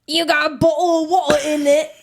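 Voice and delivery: hammed-up British glottal stops; mock British accent. Teasing an English customer with a joke, mock British accent. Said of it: "We don't say water like that".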